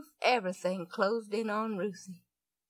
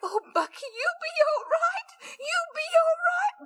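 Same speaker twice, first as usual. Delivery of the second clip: much higher, tearful pleading; desperate comforting; 4 dB louder than usual. Acted. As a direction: beg him to be all right through tears. Sister desperately comforting dying brother.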